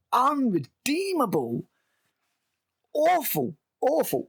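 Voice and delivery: high, stretched-out wail of disgust; exasperated verdict. Real, not acted. Reviewer damning the show, exasperated. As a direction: pronounce each word as a high, despairing condemnation.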